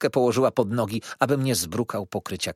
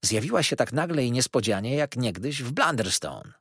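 ordinary delivery, nothing marked

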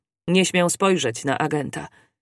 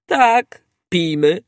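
loud happy agreement; drunken cheer; 2 semitones higher, 6 dB louder, much slower than usual. A narrator's reading. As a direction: raise the glass eagerly. Drunk servant agrees to drink.

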